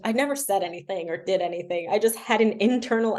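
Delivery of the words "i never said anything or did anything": normal talking voice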